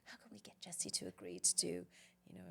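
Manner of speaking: hushed, scheming whisper; conspiratorial whisper. Retold or imitated. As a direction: whisper it like plotting a secret plan. Retelling secret strategizing with colleague.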